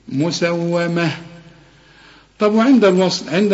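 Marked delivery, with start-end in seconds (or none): 0.1-1.1 مسومة ended with -ah, slowly; stop form. Shown as a demonstration. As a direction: pause on مسومة with H. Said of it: "ترجع لأصلها".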